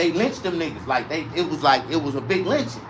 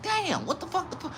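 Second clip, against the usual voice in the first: stunned disbelief; 12 semitones higher, 10 dB quieter than usual. Retold or imitated. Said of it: "I'm like".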